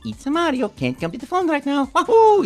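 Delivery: high, cheerful Mario message; bright answering message way up. Retold or imitated. Mario voicing a fan's phone message, cheerfully. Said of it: "on their phone message".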